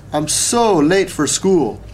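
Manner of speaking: slow, high wail of mild panic; dismayed cry. A narrator's reading. Girl late for school, crying out in dismay. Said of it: "she cried".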